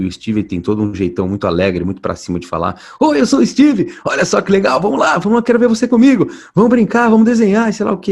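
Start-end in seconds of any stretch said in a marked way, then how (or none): 3.0-8.1 very high, loud, bubbly presenter voice; cheerful kids' show host. Retold or imitated. Voice actor imitating his upbeat kids' host character for the interviewer. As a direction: bounce it like a children's TV host calling kids to play. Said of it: "Ele fala bem alegre e tudo".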